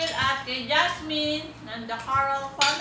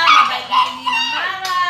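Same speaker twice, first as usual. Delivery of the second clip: excited; 6 semitones higher, 11 dB louder than usual. Real, not acted. Hyped shout out to godmother.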